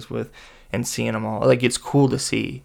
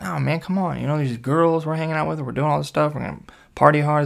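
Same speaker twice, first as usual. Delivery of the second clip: higher, insistent persuading; coaxing protest; 4 semitones higher than usual. Retold or imitated. Persuading his friend to stay and party, insistent. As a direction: talk a friend round with raised pitch.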